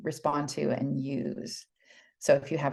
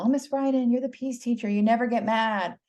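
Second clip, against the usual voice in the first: lightly voiced kids, a bit higher; imitating admiring kids; 7 semitones higher than usual. Teacher voices her pupils praising her, lightly imitated kids. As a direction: echo the kids' sweet, adoring praise. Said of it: "Kids would always say".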